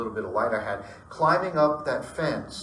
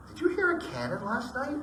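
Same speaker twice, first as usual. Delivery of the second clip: imitating wife; 13 semitones higher than usual. Wife asking her husband about the cannon, mock high voice. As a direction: lightly mimic the wife asking. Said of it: "she goes".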